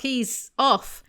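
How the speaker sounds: normal talking voice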